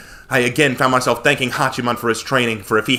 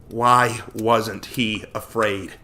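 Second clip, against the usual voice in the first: ordinary delivery, nothing marked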